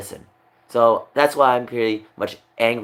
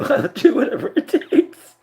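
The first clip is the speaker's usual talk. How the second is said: much higher, slower, through laughter; laughing giddily. Real, not acted. Streamer vowing to chat, giggling high. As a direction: giggle through a giddy promise.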